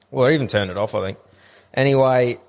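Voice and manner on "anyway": ordinary talk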